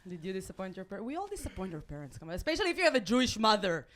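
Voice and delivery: normal talking voice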